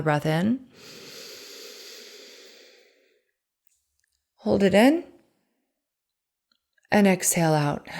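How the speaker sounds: calm, unhurried breathing cues; guided breathing. Acted. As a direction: guide the listener through each breath. Leads a breathing exercise.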